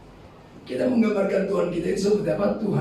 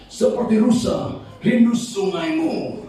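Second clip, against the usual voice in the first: raised, louder verse, slow at first; impassioned declamation; 4 semitones higher, 3 dB louder, slower than usual. Acted. Preacher reciting longing for God to the congregation, impassioned declamation. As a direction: declaim the psalm with drawn-out fervour.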